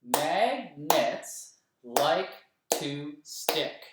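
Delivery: very slow, every syllable separated; clapped syllables. Shown as a demonstration. Teacher claps each syllable of the line with the class. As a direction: beat out "Magnets like to stick" one syllable at a time. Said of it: "Let's clap it together".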